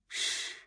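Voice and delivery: soft, long "shh"; menacing quiet shush. A narrator's reading. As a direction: hush someone quietly. Attacker hushing the girl.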